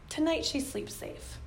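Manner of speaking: soft, slow, nearly whispered; comforting herself after a hard talk; hushed reassurance. Real, not acted. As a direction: breathe it out to calm yourself.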